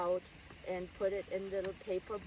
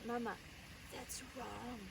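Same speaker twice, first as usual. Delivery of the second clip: put-on hushed, much softer child's whisper; whisper to mom; 12 dB quieter than usual. Little girl secretly telling her mother the bill is wrong. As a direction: lean in and breathe it quietly. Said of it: "I would whisper to my mom".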